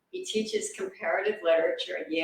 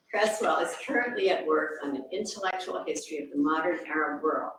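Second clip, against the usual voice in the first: usual speaking voice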